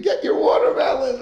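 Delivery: put-on little boy parroting the call; child repeating. Boy at home copying the seller, mild child call. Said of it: "the kid at home was repeating".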